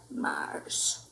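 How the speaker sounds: low soft whisper; muttered whisper. Acted. Kitten gazing at stars, soft whisper. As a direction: mutter it dreamily. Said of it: "he muttered".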